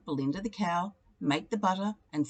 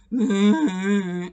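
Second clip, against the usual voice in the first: cow moo; 9 dB louder than usual. A narrator's reading. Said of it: "answered Belinda".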